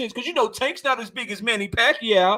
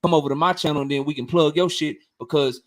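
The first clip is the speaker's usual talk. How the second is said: lower imitated self; 8 semitones lower than usual. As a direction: lower voice for his own pitch to Drico. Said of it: "I'm like".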